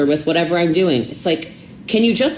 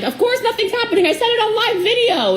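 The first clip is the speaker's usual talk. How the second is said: much higher, half-laughing flippant quote; high mock self-defense. Quoting herself brushing off her boyfriend, high and laughing. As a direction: squeak it out like a brushed-off excuse.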